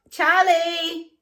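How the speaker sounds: loud, high, drawn-out call. Real, not acted. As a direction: shout for a deaf cat, stretching the name. Calling to her deaf cat.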